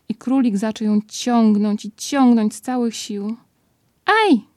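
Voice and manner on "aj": very high, loud "Aj!"; sharp cry of pain. A narrator's reading. Pooh yelps as Rabbit pulls on his paw. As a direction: yelp as the paw is pulled. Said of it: "krzyknął Puchatek".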